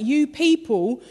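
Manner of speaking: God rebuking his people, growing furious; raging God. Retold or imitated. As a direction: let the anger build until how dare you. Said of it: "He was raging".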